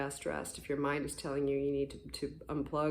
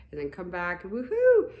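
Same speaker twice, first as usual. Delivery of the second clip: louder, higher playful woohoo; mock cheer; 4 semitones higher, 8 dB louder than usual. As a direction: cheer coming back up. Mimicking return to high.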